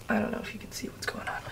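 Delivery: sleepy, just-woke-up whisper; barely awake, hushed; groggy morning voice. Real, not acted. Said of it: "morning groggy voice".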